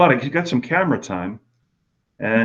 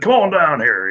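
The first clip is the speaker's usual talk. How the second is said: imitating a Southern good old boy, higher and louder; folksy Southern call to come over; good old boy drawl; 6 semitones higher, 4 dB louder than usual. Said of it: "he talks like this".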